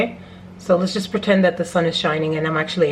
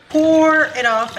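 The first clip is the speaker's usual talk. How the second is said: drawn-out call; 8 semitones higher, 4 dB louder, slower than usual. Acted. Cooking command, loud and slow.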